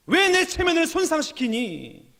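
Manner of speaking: high-pitched mock indignation; indignant outburst. Preacher voicing someone whose pride is hurt, mock indignant. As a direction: act out a flare of wounded pride. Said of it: "막 화가 그냥 버럭 나는 거예요".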